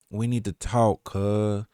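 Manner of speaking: tentative slow Southern drawl. Retold or imitated. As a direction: stretch it out softly, trying an impression. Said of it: "if I can do the [name] voice".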